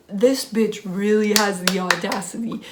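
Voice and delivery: usual speaking voice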